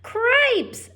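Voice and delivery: a high, loud cry of shock; startled yelp. Acted. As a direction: yelp it out in alarm. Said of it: "said Furlow".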